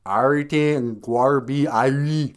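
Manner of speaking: hammed-up Jamaican accent. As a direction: draw it out in Jamaican patois. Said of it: "Jamaican accent".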